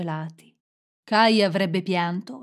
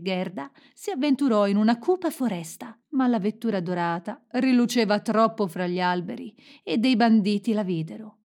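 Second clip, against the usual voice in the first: plain reading voice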